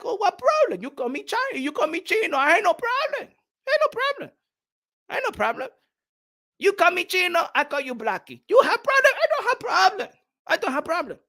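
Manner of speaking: put-on high-pitched Chinese-accented caricature, broken English; mock Chinese accent. Chinese man shrugging off the nickname dispute, mock Chinese accent. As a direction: protest innocence in a squeaky put-on Chinese accent.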